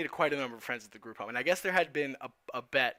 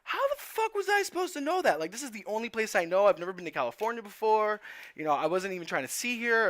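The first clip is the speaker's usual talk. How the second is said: much higher, louder incredulous protest; exasperated protest; 8 semitones higher, 5 dB louder than usual. Retold or imitated. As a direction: throw it back at him, can't believe this. Teen arguing back at the cop.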